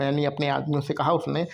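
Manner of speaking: ordinary talk, nothing marked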